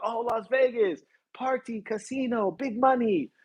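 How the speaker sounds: high-pitched impression of impressed people; mock excitement. People hearing Las Vegas mentioned, imitated excitement.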